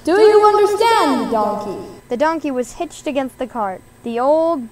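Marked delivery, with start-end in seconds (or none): 0.0-1.8 booming order over the fair speakers; loudspeaker announcement. A narrator's reading. Loudspeaker ordering the donkey to pull, loud announcement. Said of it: "The loudspeaker of the fair said loudly".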